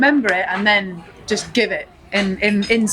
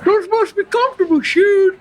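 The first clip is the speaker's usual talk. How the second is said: much higher Southern voice, slipping Scottish; Forrest Gump accent; 9 semitones higher, 3 dB louder than usual. Acted. Forrest admiring the nurse's shoes, shaky Southern accent. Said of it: "You're Scottish".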